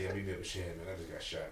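hushed, unbothered, almost flat; a shot friend calmly asking to be met, quiet and even; calm and peaceful. Retold or imitated. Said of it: "called me so calm and peaceful".

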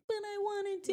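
put-on high whiny kid voice; children protesting the TV going off. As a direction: whine like a child losing TV. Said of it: "they might be like".

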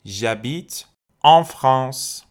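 en said as a long nasal vowel, slow and stressed; آن تودماغی و کشیده. Shown as a demonstration. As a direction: stress the nasal en before France. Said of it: "پرپوزیسیون آن توی بینی بیاد".

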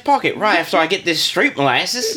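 street urchin. Pretend. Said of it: "a bunch of street urchins".

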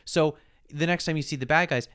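ordinary talk, nothing marked

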